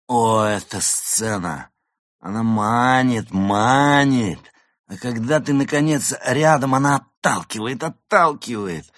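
high, loud, drawn-out prima donna rapture; theatrical goose diva. Acted. Drunk actress lecturing a young admirer about the stage, theatrical diva. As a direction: declaim with grand swelling stretched words.

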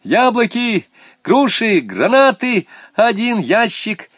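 very high, loud list; frenzied high-pitched calling; carried-away shouting. A narrator's reading. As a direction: yell out each item, getting carried away. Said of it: "Шура разошёлся и стал кричать".

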